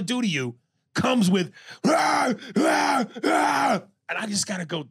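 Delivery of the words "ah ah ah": high, loud repeated anguished cries; imitated screams of terror. Enslaved person being tortured, screaming in terror. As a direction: cry out like someone being tortured.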